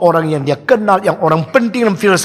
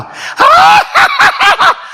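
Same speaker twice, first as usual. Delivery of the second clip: booming mock divine laugh; 4 dB louder than usual. Gods laughing at arrogant god.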